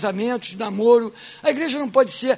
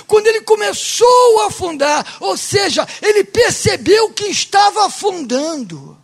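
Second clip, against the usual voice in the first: high, loud, driven sermon voice; preaching at full force; 9 semitones higher, 7 dB louder than usual. Real, not acted. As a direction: hammer the point home to the congregation. (pregação inflamada, em voz alta)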